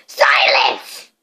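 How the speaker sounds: low, loud yell. A narrator's reading. Uncle Vernon yells at Harry for silence. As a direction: bellow for silence. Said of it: "yelled Uncle Vernon".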